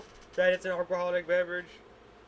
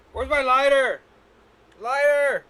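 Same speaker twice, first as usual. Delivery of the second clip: calling out; 8 semitones higher, 11 dB louder than usual. Real, not acted. Calling out for lighter.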